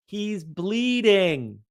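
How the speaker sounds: each word drawn out, slow and pointed; slow emphatic point. Retold or imitated. Telling Caroline the obvious, slow and emphatic. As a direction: spell it out slowly for her. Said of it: "And I'm like".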